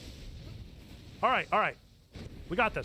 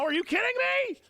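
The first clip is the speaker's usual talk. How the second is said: usual speaking voice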